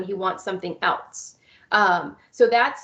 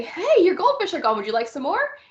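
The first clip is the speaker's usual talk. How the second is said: bright prompt; 6 semitones higher than usual. Shown as a demonstration. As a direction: cheerfully beat him to the punch. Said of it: "I'm gonna say".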